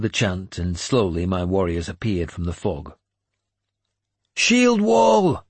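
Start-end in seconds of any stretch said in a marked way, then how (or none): 4.4-5.4 very high, loud battle order; bellowed command. A narrator's reading. Warrior calling men to form a shield wall, bellowing. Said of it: "Finan bellowed".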